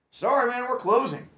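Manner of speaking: high, loud brush-off; brusque clerk. A narrator's reading. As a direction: shut him down fast.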